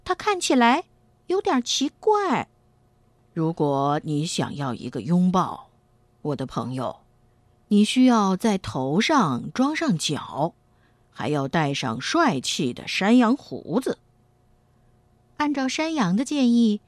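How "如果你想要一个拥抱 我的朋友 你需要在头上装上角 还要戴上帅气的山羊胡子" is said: put-on a calm old billy goat giving advice. A narrator's reading.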